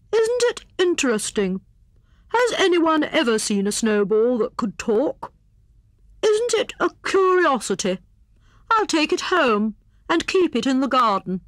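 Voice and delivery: high, excited hare voice; marvelling Hare. A narrator's reading. Hare plans to keep the talking snowball, marvelling. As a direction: exclaim over a wonderful curiosity.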